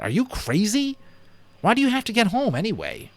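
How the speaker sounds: put-on high, disbelieving boy; incredulous friend. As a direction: sound baffled he won't stay. Patrick incredulous at Omri.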